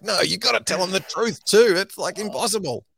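mock disbelief at the podcast host; exasperated protest. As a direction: insist it can't be done, pitch up. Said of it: "And I'm like".

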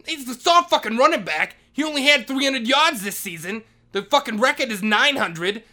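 mock sarcastic yell. Janitor mocking the star player claim, sarcastic yell. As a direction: yell it back with scorn. Retold shouting match with principal.

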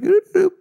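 very high, loud squeak mimicking the tray; squeaky tray noise. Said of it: "It's just like".

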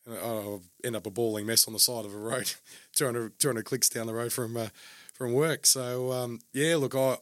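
ordinary talk, nothing marked

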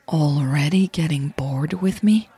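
whispered with a mock pout. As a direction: tease her in a pouty whisper. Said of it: "She threw in her best pout".

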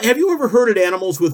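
ordinary talk

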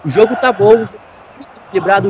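usual speaking voice

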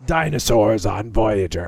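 low, slow trailer announcer; movie trailer voice. Retold or imitated. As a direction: boom it like a trailer. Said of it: "they tried to sell it as".

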